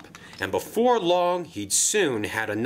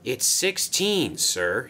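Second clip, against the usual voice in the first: usual speaking voice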